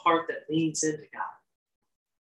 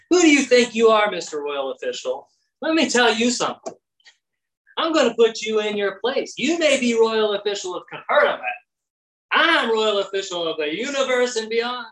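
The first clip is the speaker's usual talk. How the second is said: mock rebuke; 6 semitones higher than usual. Imagined Jesus scolding the royal official, hammed-up higher rebuke. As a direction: put him in his place, hammed up.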